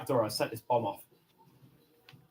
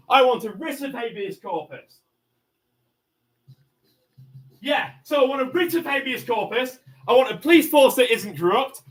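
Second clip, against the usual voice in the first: loud, high, sustained furious rant; raging shout; 5 semitones higher, 7 dB louder than usual. Real, not acted. Barricaded man shouting his demands at the police, raging. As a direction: hammer every demand home, yelling.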